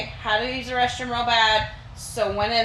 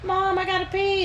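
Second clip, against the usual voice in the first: put-on high, whining kid voice; kid whine; 8 semitones higher, 3 dB quieter than usual. Child voice asking mom.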